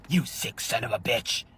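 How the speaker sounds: a real angry outburst at the uncle. Real, not acted. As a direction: spit it out in real outrage. Outraged at abuser.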